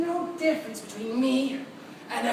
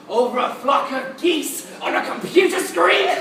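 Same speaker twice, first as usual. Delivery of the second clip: loud, high, mocking disbelief; manic, scornful outburst; incredulous rant; 4 semitones higher, 7 dB louder than usual. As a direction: build loudly at the absurdity. Madman ranting at absurd wars.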